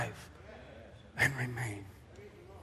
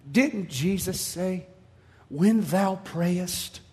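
solemn, deliberate; much slower than usual. Retold or imitated. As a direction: stretch the words out with grave patience. Voices the heavenly voice quoting scripture.

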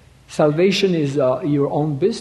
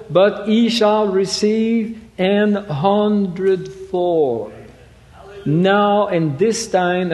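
raised, slow preaching read of the verse; solemn raised sermon reading; scripture recitation; 4 semitones higher than usual. Acted. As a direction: declaim the Bible passage from the pulpit.

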